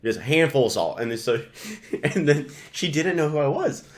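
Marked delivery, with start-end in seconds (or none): 0.0-1.0 put-on southern accent. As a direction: order it like a Southerner. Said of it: "talking in a real southern accent".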